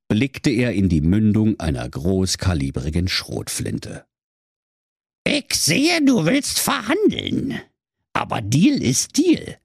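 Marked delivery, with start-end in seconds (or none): 5.3-9.6 high, slow, unmoved villain; calm menace at gunpoint; cool villain. Acted. Dwarf cool in standoff.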